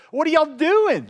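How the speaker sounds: loud, high taunting question; mocking. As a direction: call out in mock disbelief. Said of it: "Elijah began mocking them".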